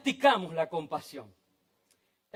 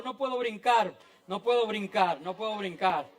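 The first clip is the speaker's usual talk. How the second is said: ordinary talk, nothing marked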